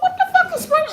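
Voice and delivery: a shocked shriek with a laugh in it; shrieking disbelief. Real, not acted. Watching Mercedes dance, a high laughing shriek. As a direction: squeal it out high and loud, half laughing.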